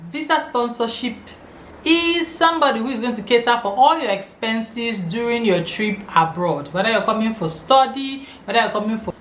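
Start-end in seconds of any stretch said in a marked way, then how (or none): none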